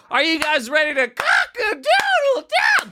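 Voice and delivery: hype arena announcer. Pretend. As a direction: pump up an arena crowd, goofy and big.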